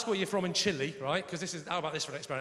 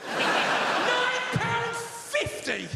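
very slow, high, louder outraged question; appalled disbelief; 5 dB louder, much slower than usual. Acted. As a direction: stretch every word in mock horror. Repeated price, very drawn out.

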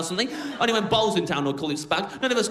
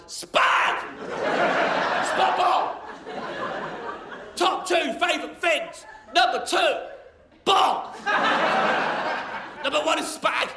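high, strained yobbish shouting; hammed-up high-pitched Brit yelling; loutish bellowing; 9 semitones higher, slower than usual. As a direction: bellow it like a proud, brainless lout. Acts Brits as foreigners see them.

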